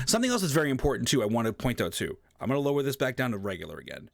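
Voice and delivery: usual speaking voice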